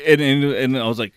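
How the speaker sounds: normal talking voice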